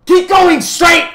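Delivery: very high, slow, full-out shout. A narrator's reading. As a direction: shriek the order, stretching each word. The voice repeats it more forcefully.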